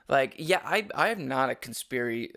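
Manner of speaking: usual speaking voice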